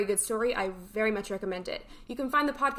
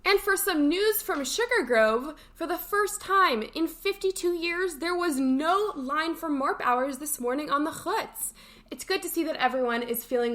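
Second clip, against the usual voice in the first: slow, higher announcer-style news read; 5 semitones higher than usual. Acted. Host reading camp news to listeners, slow announcer style. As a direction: read the camp headline like a newscaster, slowly.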